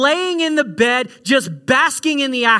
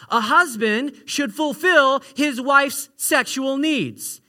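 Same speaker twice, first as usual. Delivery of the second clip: normal talking voice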